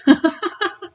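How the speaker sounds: short high giggle; light laugh, pitch jumping up. Real, not acted. Laughs at her indecision.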